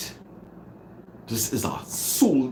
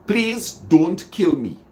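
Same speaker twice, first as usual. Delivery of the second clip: slow high plea, kill stressed; begging plea; 6 semitones higher, 4 dB louder than usual. Shown as a demonstration. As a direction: plead slowly and stress kill. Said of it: "leave out the don't".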